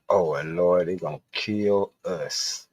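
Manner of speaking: low, slow, whispered dread; mock panic. Teen caught by both moms, low and scared. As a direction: mutter it like you're doomed. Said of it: "I said".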